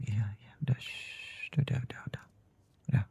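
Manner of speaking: hushed, shushing reassurance; quiet her gently, all in a whisper; soothing whisper. Acted. Husband soothing wife's crying. (bisikan menenangkan istri)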